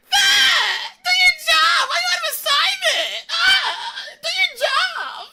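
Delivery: very high, loud, screechy whine; whiny coach impression. Streamer imitates his old football coach, whiny shrieking. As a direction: yell like a clingy girlfriend crossed with the Joker. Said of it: "a really whiny, like, c-, clingy girlfriend".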